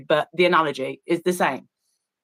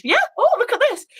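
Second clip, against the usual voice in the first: very high, gushing mock pride; proud as punch; much faster than usual. The Scientologist showing off on the channel, proud as punch. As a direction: show off in a squeaky, delighted voice. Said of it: "proud as punch".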